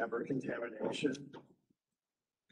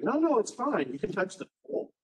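insistent reassurance; 7 semitones higher than usual. Retold or imitated. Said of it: "I'm trying to tell them, like".

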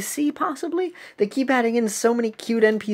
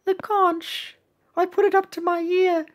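timid little cookie; 11 semitones higher than usual. Acted. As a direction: answer in a small high voice. Voices Peppermint Cookie answering captain.

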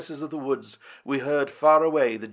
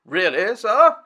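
sharply higher, louder outrage; indignant cry; 9 semitones higher, 9 dB louder, slower than usual. A narrator's reading. Professor crying out at Lord John's joke, indignant. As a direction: bristle with offended surprise. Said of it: "cried the Professor".

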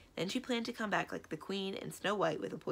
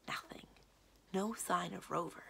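usual speaking voice

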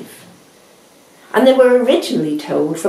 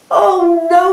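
high, louder cry of horror; dismayed cry; 10 semitones higher, 5 dB louder than usual. A narrator's reading. Hare seeing Tortoise nearly at the line, high horrified cry. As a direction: gasp it out in horror. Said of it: "cried Hare".

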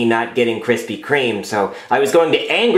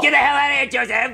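high-pitched shouted scolding; high squeaky angry yell; mock angry shout; 11 semitones higher than usual. Angry at Joseph.

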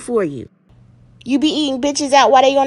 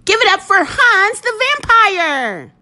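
announcer hype; 7 semitones higher, slower than usual. Acted. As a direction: build the crowd up for the guest. Host introducing the guest.

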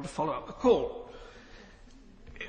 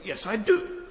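ordinary delivery

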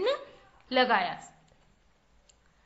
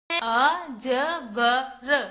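अजगर drawn out very slowly, each syllable separate: अ-ज-ग-र; 3 dB louder, much slower than usual. Shown as a demonstration. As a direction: spell out अजगर slowly for the children. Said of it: "कौन कौन से अक्षर से मिलकर बना है".

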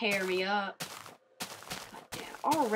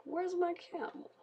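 much higher squeaky mob quote; lightly voiced mob missing its camel; 11 semitones higher than usual. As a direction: pitch it up like a silly game mob. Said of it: "then they're like".

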